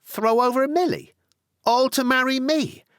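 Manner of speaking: high, loud delighted cry; loud outburst. Acted. Hannah thrilled Tony will drop Milly, breaking out loud. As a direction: burst out with glee, far up. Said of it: "broke out Hannah, quite loud".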